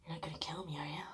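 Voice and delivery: very soft imitation of him; hushed eerie question. He asks her during the Saw movie, hushed. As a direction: say it low in volume, almost under the breath.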